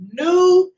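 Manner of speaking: "New" held out, high and loud; emphatic NEW. Shown as a demonstration. Host savoring the word "New", said high and emphatic. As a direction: punch "New" high and let it ring. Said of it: "It's something about the new".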